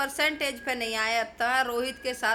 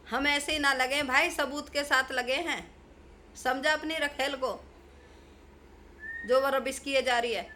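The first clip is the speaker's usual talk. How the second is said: angry rant; 3 semitones higher than usual. Real, not acted. (गुस्से में ऊँची आवाज़ में डाँटना)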